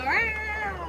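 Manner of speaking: high "Meow" like a cat. Pretend. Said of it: "How about go".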